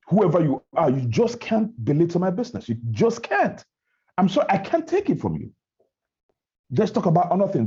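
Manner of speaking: high-pitched, emphatic indignation; worked-up, insistent protest; indignant refusal. Acted. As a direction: shut the critic down, refusing to take it. Acting out confronting belittlers of business.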